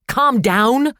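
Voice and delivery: high, loud shriek; mother throwing the words back, shrieking. A narrator's reading. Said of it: "she shrieked".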